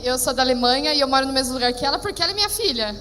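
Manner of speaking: ordinary delivery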